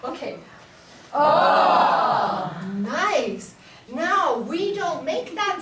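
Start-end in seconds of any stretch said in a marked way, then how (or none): 1.1-2.5 louder oh with breath; breathy oh. Shown as a demonstration. Teacher leading her choir, breathy oh. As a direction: let the oh sigh out. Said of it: "You are going to be my choir".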